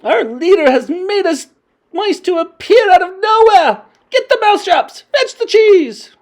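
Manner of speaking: high, loud, eager witch voices; excited witch crowd. A narrator's reading. Witches believing their leader did it, excited shouting. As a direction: bark orders in a frenzy.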